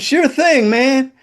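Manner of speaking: high, bright mock-cheerful sure thing man; fake-nice yes that means the opposite; sarcastic sure thing, hiding annoyance; sarcastic agreement. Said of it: "like, "Fuck you".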